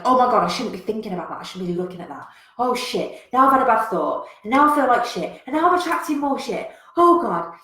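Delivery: panicky self-talk. Retold or imitated. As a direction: spiral into anxious panic.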